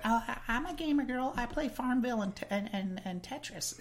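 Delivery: high-pitched girly put-on voice; mock gamer girl. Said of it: "those other gamer girls like".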